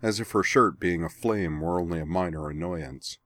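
plain reading voice

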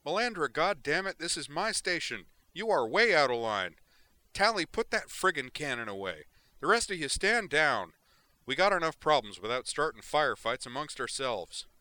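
loud, high furious tirade; angry yelling. Acted. Chen scolding the mayor and officers, angry yelling. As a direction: chew out the whole room.

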